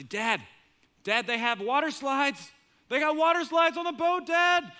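put-on high, loud, rising kid shouts; thrilled kids. Kids find water slides online.

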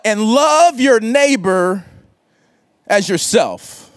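slow scripture reading. Acted. Scribe quotes the commandment.

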